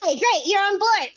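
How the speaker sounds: usual speaking voice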